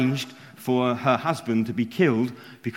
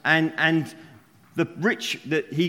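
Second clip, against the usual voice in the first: ordinary talk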